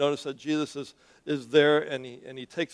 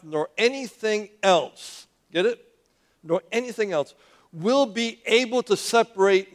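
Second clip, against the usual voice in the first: preaching scripture; 3 semitones higher, 4 dB louder than usual. Acted. Pastor reading Romans to church.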